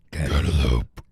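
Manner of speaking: low, loud creature voice; deep loud creature voice; cantaloupe creature voice. Pretend. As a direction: boom the name like a fruit monster. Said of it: "you're gonna think about me going".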